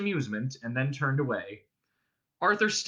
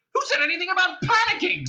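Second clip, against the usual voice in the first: very high, loud indignant retort; Arthur snapping; 6 dB louder than usual. A narrator's reading. Panicking man denying it to his friend, snapping. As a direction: snap back hotly. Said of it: "snapped Arthur".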